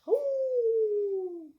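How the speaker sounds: long loud high howl; mother wolf howling. Acted. Mother wolf calling through the woods, long howl. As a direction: howl from far across the woods. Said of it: "she hears".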